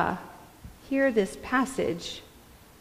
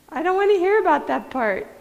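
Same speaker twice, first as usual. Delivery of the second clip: mock whiny refusal; 9 semitones higher, 4 dB louder, faster than usual. Repeated, pitched up mockingly.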